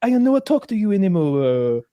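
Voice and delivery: put-on high, halting Japanese-accented English; mock Japanese lady. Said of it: "And she's just like".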